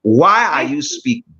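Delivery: ordinary delivery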